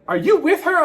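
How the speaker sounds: high, accusing question; suspicious guys. Retold or imitated. Bar guys confronting the narrator, accusing. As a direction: ask it pointed, suspicious. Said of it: "look at me like".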